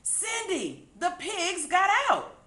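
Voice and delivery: high, loud shout. A narrator's reading. Sam shouting to Cindy across the farm. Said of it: "Then he shouted".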